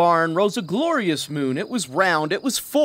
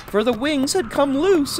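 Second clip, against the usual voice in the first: ordinary delivery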